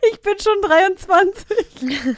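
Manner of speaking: put-on high, slow, offended young man; indignant young guy. Mimicking the young cyclist defending his age, high and slow. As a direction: stretch it out like a hurt boy insisting on his age. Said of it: "er so".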